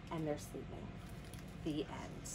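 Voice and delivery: low, soft bedtime close; quietly, so sleepers stay asleep; hushed ending. A narrator's reading. Storyteller shows the friends sleeping, hushed ending.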